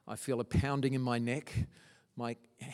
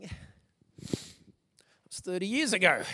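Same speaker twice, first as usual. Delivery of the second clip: voice catching; 4 semitones higher, 3 dB louder than usual. Real, not acted. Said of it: "I'm so emotional this morning".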